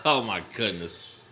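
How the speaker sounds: usual speaking voice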